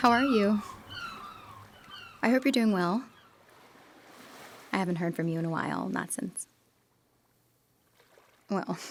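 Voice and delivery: ordinary talk, nothing marked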